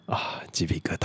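whispered aside. Pretend. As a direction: breathe it softly. Joking aside. (轻声耳语)

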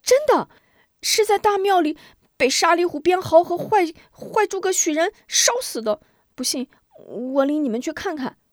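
put-on kids eagerly shouting over each other; excited children. A narrator's reading. Children shouting to the elders and offering to lead them. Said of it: "孩子们又是一阵乱嚷".

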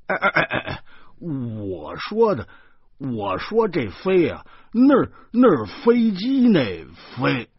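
high, loud stammering explanation; sputtering, drawn-out self-correction; flustered protest. A narrator's reading. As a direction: stretch the words while scrambling to explain. Flustered man explains himself after insult.

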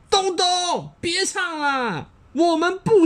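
high, slow mock roar of a group; siblings roaring. Fifteen siblings scolding Dongdong to stop. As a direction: shout it together like annoyed kids. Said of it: "指着东东大吼".

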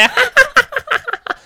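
high evil cackle; witch cackle. Acted. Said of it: "巫婆的声音". (巫婆的怪笑)

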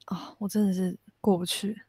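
breathy whispered lament; whispered despair. Real, not acted. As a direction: whisper it in defeat. Despairing sigh over old problem.

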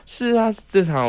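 high, quick agreeing uncle voice; lightly voiced uncle; eager uncle. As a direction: play the uncle falling over himself to agree. Voicing uncle's eager reply.

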